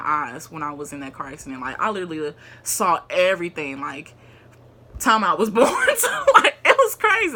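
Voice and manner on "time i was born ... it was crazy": laughing high and loud. Real, not acted. Laughing at life flashing by.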